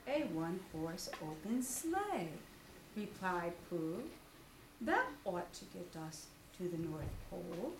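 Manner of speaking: lightly voiced low bear; Pooh voice. Pooh explaining his plan, low bear voice.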